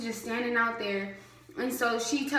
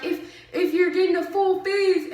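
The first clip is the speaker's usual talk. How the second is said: put-on heavy Southern accent, higher, "full fizz" slurred together; Southern mumble; 7 semitones higher, 4 dB louder than usual. Imitating the Southern officer's heavy accent on "full fizz". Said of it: "it sounded like she was saying".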